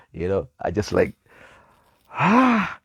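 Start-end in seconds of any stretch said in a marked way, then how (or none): 2.1-2.8 high, loud Ronaldo-style celebration cry; goal celebration cry. Retold or imitated. Reliving his joy at the news with a goal cry. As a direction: let out a goal celebration shout. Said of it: "when Ronaldo scores a goal".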